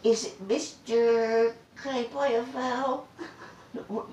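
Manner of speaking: high, drawn-out mock stumbling over a name; mock someone slowly sounding out a surname; hesitant name-reader. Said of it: "they look at the paper".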